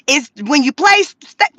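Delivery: ordinary delivery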